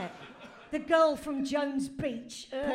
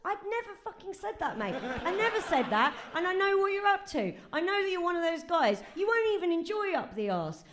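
higher, louder Aussie telling-off; indignant rant; 6 semitones higher, 5 dB louder than usual. Acted. Gwendolen scolding Vincent Price for his come-on, indignant rant. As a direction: tear strips off him, mate.

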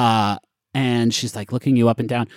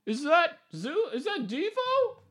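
very high, soft far-off call; distant questioning character; 9 dB quieter than usual. Acted. Felix asks from down the pipe who is there, high and faint.

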